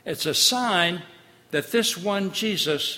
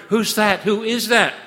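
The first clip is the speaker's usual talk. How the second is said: raised, higher anxious questions; terrified disciples in the boat; frightened disciples; 6 semitones higher, 8 dB louder than usual. Acted. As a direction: call out in alarm. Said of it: "they wonder".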